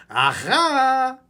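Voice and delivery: high, loud aha; triumphant. Real, not acted. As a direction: gotcha exclamation. Coach catches the mistake.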